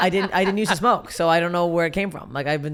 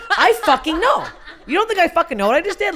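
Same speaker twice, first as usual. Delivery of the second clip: higher, louder exasperated shout through laughter; exasperated outburst; 12 semitones higher, 6 dB louder than usual. Retold or imitated. Telling the manager she knows she bombed, higher and louder, laughing. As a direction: fire it back at the gloating manager, laughing. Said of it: "I'm like".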